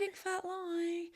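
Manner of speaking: high, slow teasing voice. Real, not acted. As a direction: tease in a sing-song. Teasing agreement.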